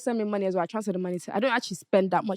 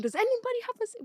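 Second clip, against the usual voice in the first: mock scream; 13 semitones higher, faster than usual. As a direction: imitate screaming out to strangers without real volume. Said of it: "I screamed".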